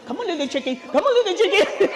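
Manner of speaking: put-on terrible Italian accent, lightly done; Italian neighbor coaxing chickens, bad accent. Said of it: "it's a terrible accent".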